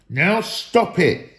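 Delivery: slow it down, fed up; the trolls ending the quarrel; stern troll. A narrator's reading. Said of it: "said Tom and Bert together".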